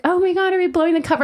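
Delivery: high, fast excited question; excited fans. Retold or imitated. Fans realizing they ruined the spying, high fast voice. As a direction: rush it out high.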